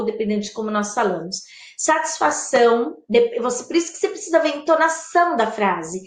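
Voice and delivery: ordinary talk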